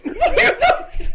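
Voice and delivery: high, loud hammed-up yell; mock screaming question. Friend retells Jonah Hill screaming at McLovin.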